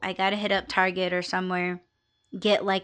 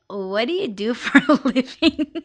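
laughing question; 3 semitones higher than usual. Retold or imitated. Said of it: "she was like".

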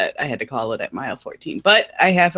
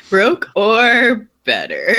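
laughing; 5 semitones higher, much slower than usual. Real, not acted. Laughing through question.

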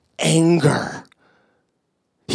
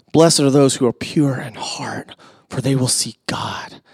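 hushed whisper of scripture; soft reverent whisper; 5 semitones lower, 6 dB quieter than usual. Real, not acted. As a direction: breathe the words reverently. Reading beatitudes hushed.

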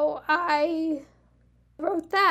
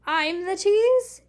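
slow, louder, higher disbelief at "tease"; indignant surprise; 4 semitones higher, 4 dB louder, slower than usual. Acted. Olivia teasing Ed in bed, playful indignant disbelief. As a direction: throw the accusation back at him in mock outrage. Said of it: "her eyes wide".

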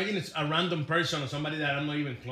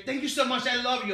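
gushing to cashier; 7 semitones higher than usual. Retold or imitated. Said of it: "even at the grocery store".

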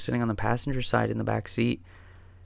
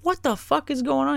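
panicked, much higher and slow, voice cracking up; freaking out; 11 semitones higher, slower than usual. Retold or imitated. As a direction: imitate a driver who just realized he crashed. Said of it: "He starts freaking out".

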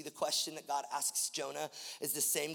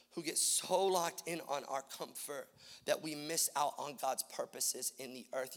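normal talking voice

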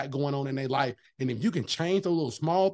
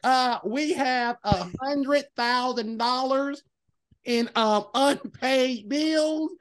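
retold owner; 6 semitones higher, much slower than usual. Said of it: "He's like".

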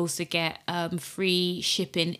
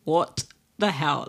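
retold disbelief; 3 semitones lower than usual. Retells her dismay at more trauma.

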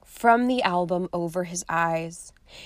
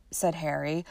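ordinary delivery

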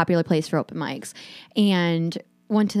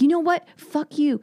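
retold angry outburst, pitched high; high, imitated kiss-off from an irate comic; going off the rails; 7 semitones higher than usual. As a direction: act out his fury in a raised pitch. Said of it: "going off the rails".

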